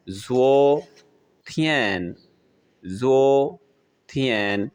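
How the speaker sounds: ज़ो drawn out rising, थियन held as its own word; Chinese tone demo. Shown as a demonstration. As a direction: slowly model ज़ो with second tone, थियन with first tone. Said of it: "इसमें second tone है और थियन में". (ज़ो उठते सुर में, थियन अलग से खींचकर)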